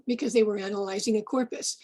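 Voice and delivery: ordinary delivery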